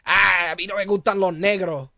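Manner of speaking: imitating an old school Puerto Rican's prejudice. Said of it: "these old school Puerto Ricans".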